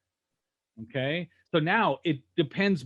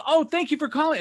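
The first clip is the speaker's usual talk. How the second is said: high, gushing phone greeting; excited receptionist; 11 semitones higher, 4 dB louder than usual. Retold or imitated. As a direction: imitate an over-eager receptionist, pitch way up. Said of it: "they're so excited to be there".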